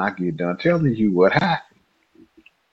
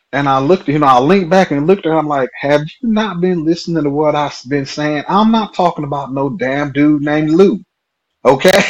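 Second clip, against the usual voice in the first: raised, louder disbelief; incredulous; 7 semitones higher, 4 dB louder than usual. Retold or imitated. To the coworker who misheard him, exasperated disbelief. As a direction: lean back and ask if she's been listening.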